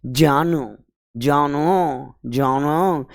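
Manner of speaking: जानो stretched very slowly, starting on j; drawn-out demonstration. Shown as a demonstration. Teacher modelling the corrected word for students, slowly stretched. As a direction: draw out each syllable of जानो, falling at the end. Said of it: "क्या बोलेंगे".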